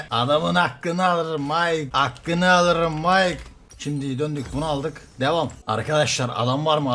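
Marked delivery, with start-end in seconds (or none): 0.1-3.4 drawn-out, loud gloat; slow gloating. Real, not acted. Gamer gloating slowly to teammate Mike. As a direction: stretch each word out triumphantly.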